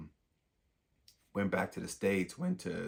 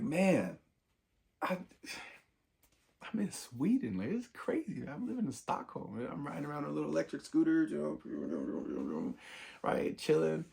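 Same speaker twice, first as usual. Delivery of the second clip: much higher, excited retelling of his own words; thrilled disbelief; 8 semitones higher than usual. Retold or imitated. Telling a friend about his new life, in high excited disbelief. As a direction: gush about being in Sweden.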